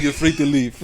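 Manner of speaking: badly faked Japanese accent; botched Japanese accent. Replaying Ishido's line for laughs, botched Japanese accent. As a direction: attempt a Japanese accent and miss. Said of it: "I can't make a Japanese accent".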